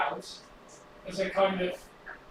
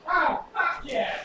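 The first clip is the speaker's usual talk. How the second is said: excited; 8 semitones higher, slower than usual. Real, not acted. Triumph, project works.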